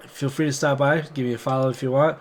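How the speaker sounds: ordinary talk, nothing marked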